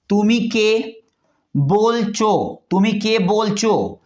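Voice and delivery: तुमी के बोलचो drawn out slowly, syllable by syllable; slow Bengali demo. Shown as a demonstration. Teacher sounding out Bengali words for learners, slow and clear.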